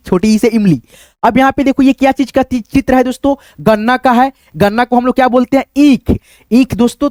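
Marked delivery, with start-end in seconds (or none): 5.8-7.1 ईख with a drawn-out long ई, slowly; long ee demo. Shown as a demonstration. Teacher stretching ईख for students. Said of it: "तो यहाँ पे बड़ी ई हो गई".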